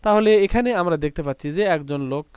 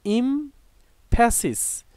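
"Emphasis" slowly, split after the first syllable; slow syllable break; 6 semitones higher, much slower than usual. Shown as a demonstration. Teacher introducing a new word to learners, "emphasis" slowly broken up. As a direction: say "emphasis" in two slow parts with a clear gap.

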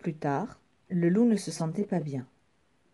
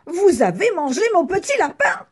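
high, loud cry of shock; outraged exclamation; 12 semitones higher, 9 dB louder than usual. Acted. Doctor realising the wolf ate his rabbit, shocked shout. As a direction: exclaim it at full voice, high and indignant. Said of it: "le médecin s'exclama".

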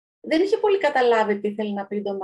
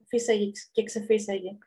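ordinary delivery

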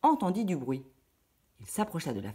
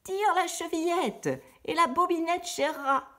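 put-on much higher, old granny voice; old grandmother voice; 13 semitones higher than usual. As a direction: invite her in like a trusting grandma. Grand-mère répond. (grand-mère répond avec une voix de vieille dame)

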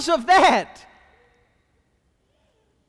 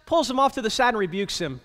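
ordinary talk, nothing marked

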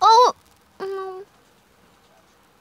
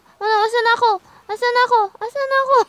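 panicked; 8 semitones higher, 8 dB louder, faster than usual. Real, not acted. Lost in game, panicking.

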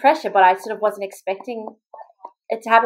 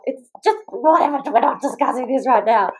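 high-pitched retold exasperation; mock-flustered refusal to discuss it; strained dismissal; 5 semitones higher than usual. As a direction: cut off the question, too strained to explain. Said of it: "I just couldn't talk".

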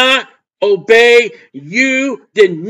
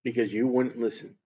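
hushed verdict; 11 semitones lower, 13 dB quieter than usual. Acted. God's verdict after a pause.

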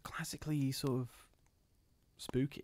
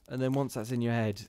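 plain reading voice

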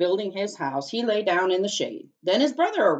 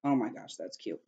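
usual speaking voice

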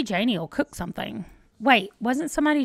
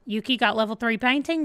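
usual speaking voice

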